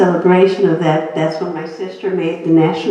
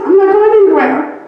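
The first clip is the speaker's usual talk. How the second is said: much higher and louder, fighting back; defiant; 13 semitones higher, 6 dB louder than usual. Retold or imitated. Sick wife answering her husband's fear, loud defiance. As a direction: declare it like a challenge. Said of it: "I was mad and ready to fight".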